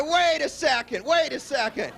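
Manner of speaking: high, slowed-down retold objection; mock protest. Mentor stops the kid who wants him as partner, mock protest. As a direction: halt someone with a high-pitched, acted protest.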